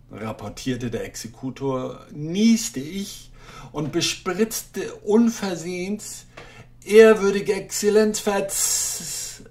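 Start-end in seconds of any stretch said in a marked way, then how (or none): 2.1-9.4 high, nervous stammering apology; flustered clerk pleading; timid clerk. Acted.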